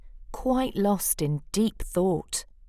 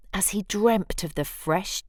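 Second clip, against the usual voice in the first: usual speaking voice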